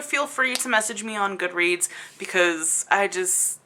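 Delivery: ordinary talk, nothing marked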